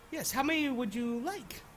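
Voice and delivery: shopkeeper voice. Acted. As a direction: sell it like a friendly merchant.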